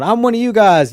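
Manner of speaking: high, eager plea to belong; eager outsider. Retold or imitated. Outsider pleading with the Irish to accept him, high and eager. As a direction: pitch it up like a hopeful outsider.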